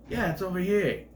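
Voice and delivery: put-on botched accent that lands near Brooklyn; attempted Brooklyn accent. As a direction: imitate her, slipping lightly into Brooklyn. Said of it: "I sounded more like Brooklyn".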